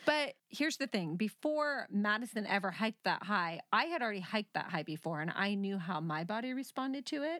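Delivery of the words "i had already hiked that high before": ordinary delivery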